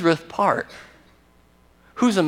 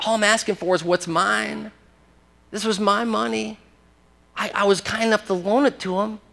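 softer, higher mock-innocent plea; mock defensive whine; self-justifying excuse; 5 semitones higher, 4 dB quieter than usual. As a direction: play the wronged lender, small voice. Voicing the lenders' excuse.

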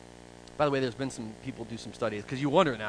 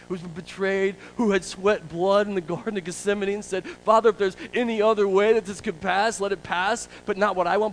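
high, driving sermon delivery; raised-voice pulpit appeal; impassioned preaching; 7 semitones higher, 6 dB louder than usual. Real, not acted. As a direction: preach it with rising force. Preacher building at full force.

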